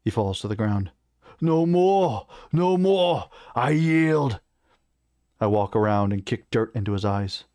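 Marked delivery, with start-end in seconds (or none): 1.4-4.4 high, loud cries of surrender; defeated warrior's desperate yielding; pleading beaten orc. A narrator's reading. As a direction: cry out for mercy, pitched well up. Beaten orc begs for mercy.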